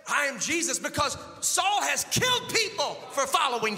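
rising shout of the name. Acted. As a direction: proclaim it out loud. Same words shouted on the repeat.

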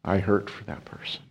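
breathy tender whisper. Acted. Jesus holding a tear close, whispering tenderly.

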